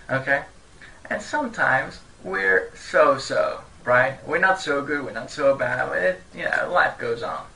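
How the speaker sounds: normal talking voice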